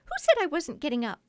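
fast, high, loud indignant retort; indignant cry. A narrator's reading. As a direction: snap it back quickly. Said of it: "she cried".

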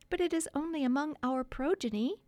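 high-pitched make-believe mother; coaxing wife. A narrator's reading. Pretend wife to husband about the children, coaxing high voice. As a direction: coax him in a high voice.

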